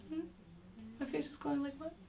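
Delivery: small, high, soft questioning voice; curious child. Retold or imitated. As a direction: imitate a puzzled child asking quietly. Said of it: "And they get curious".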